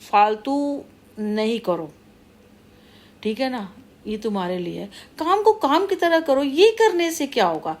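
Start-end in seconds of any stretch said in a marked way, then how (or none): 5.2-7.8 high-pitched, indignant challenge; real, fed-up outburst; exasperated scolding. Real, not acted. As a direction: demand sense from them, pitch leaping up. Scolding the wrongdoer.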